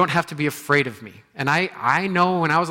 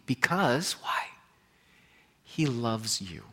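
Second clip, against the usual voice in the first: whispered, slow and much softer; hushed tender aside; 4 semitones lower, 7 dB quieter, slower than usual. Real, not acted. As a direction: lean in and breathe it to them.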